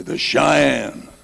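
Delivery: high, loud declaration; proud answer. Retold or imitated. Bobby answers the lieutenant, loud and high. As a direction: announce it boldly.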